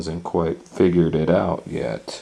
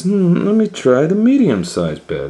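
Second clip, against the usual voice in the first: put-on high, loud little girl voice; Goldilocks trying beds; 12 semitones higher, 7 dB louder than usual. Girl in the story choosing a bed, high and bright. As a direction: chirp it out like a curious girl. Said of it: "and she says".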